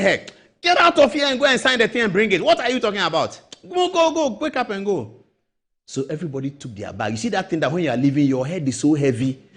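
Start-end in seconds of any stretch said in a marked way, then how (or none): 0.0-5.1 angry judge. Retold or imitated. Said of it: "The judge was so angry".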